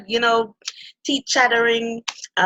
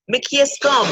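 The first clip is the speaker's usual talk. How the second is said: Jamaican patois phrase "Mickey Strom", said higher and a little louder; 6 semitones higher, 4 dB louder, slower than usual. Shown as a demonstration. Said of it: "as we would say in Jamaican dialect".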